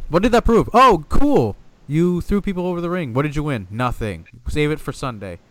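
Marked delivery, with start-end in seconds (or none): 0.1-3.1 sarcastic rant. Real, not acted. Ranting sarcastically about battle royals.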